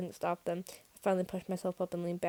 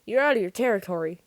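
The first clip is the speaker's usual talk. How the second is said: usual speaking voice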